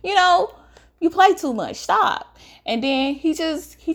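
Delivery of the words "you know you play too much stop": higher, light laughing stop; brushing him off as a joke; teen telling a friend he plays too much; playful protest. Retold or imitated. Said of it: "I'm laughing".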